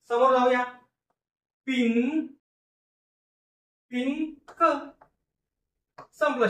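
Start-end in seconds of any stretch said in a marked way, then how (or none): 1.7-4.6 pink drawn out slowly, sound by sound, rising. Shown as a demonstration. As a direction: stretch pink slowly as if decoding it. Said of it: "pink, सलग वाचन करा".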